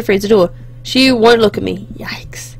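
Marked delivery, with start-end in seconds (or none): 2.0-2.5 whispered. Acted. Tense breakfast reaction.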